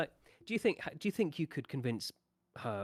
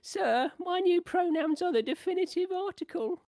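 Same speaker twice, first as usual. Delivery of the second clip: put-on high squeaky child voice, much higher and louder; mock kid voice; 5 dB louder than usual. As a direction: pipe it up like a cheeky pupil. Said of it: "had some kid coming to their school and go".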